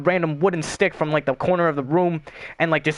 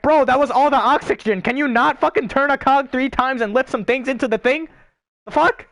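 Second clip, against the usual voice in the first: mock exasperated rant; 8 semitones higher than usual. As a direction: vent at the useless teammate, rising to the end. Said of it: "And then you're like".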